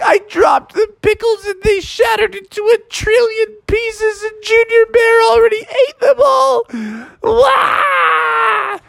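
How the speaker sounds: very high wailing cry; hammed-up weeping. Bubby crying to dad about the pickles, high wailing. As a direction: bawl like a little cub. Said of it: "then he started to weep".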